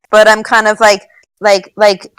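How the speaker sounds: ordinary talk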